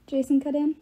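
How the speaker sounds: usual speaking voice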